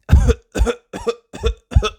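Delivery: squeaky high little cough; hammed-up high cough. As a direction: cough like a small child. Children coughing in class.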